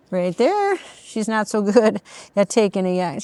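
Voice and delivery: ordinary talk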